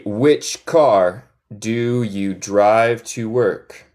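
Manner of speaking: slow, separated words; slow model question. Shown as a demonstration. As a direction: model it word by word for learners. Teacher models question again.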